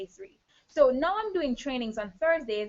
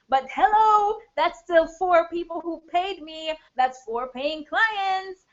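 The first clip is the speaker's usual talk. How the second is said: high, loud, proud exclamation; emphatic triumph; 6 semitones higher, 8 dB louder, slower than usual. Real, not acted. Coach defends landing four paying clients, emphatic triumph. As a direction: hammer it home, louder and higher.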